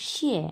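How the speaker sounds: শিয়ে with a sharp falling fourth tone; 第四声, 从高往下降. Shown as a demonstration. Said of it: "চার number tone".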